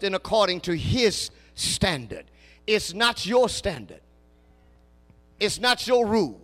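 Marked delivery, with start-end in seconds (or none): none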